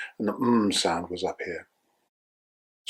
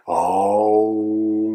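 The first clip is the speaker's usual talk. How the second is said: a long, loud held "oh"; sustained oh; 7 dB louder than usual. Shown as a demonstration. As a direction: sound out "oh" fully on the breath. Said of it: "demo of the vowel sound".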